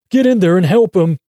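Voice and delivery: high, loud, frantic command; screamed order. A narrator's reading. Davy pushing the cowboy into the trunk, screamed order. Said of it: "Frog-Eyed Davy screams".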